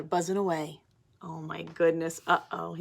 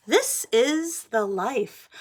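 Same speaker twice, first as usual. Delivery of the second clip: slow, content pigeon; blissful sigh-out; 5 semitones higher, 5 dB louder, much slower than usual. Acted. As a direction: savour every word, slowly. Pigeon relaxing in tub.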